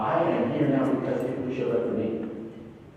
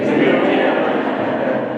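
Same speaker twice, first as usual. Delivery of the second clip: short loud laugh; amused laugh; 8 dB louder than usual. Real, not acted. Father laughing about his son's chemistry grade, short loud laugh.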